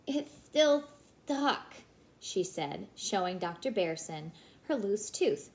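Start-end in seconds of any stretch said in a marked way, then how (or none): 0.1-1.7 put-on high little girl, a bit louder; little sister voice. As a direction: speak like a small child.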